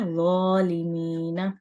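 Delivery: slow ज़ालिमीना, mouth round, soft zo. Shown as a demonstration. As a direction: recite ज़ालिमीना softly. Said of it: "ज़ो को नरमी से पढ़ा जाएगा".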